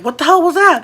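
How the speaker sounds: high, loud startled outburst; freaking out. Retold or imitated. Dad startled by a hit on the van, freaking out. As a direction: imitate a dad's alarmed cry. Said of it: "my dad freaks out".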